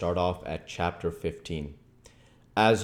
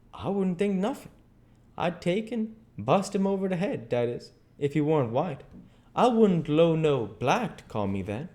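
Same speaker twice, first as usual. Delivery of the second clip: higher, affronted dialect voice; Jim, indignant; 8 semitones higher than usual. Acted. Jim takes the French words as insult, indignant. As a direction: bristle in dialect, pitched up.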